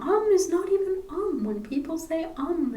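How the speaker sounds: "um" said as a hummed filler sound each time; musing on um. Retold or imitated. To herself while editing, musing on how "um" sounds. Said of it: "I'm like".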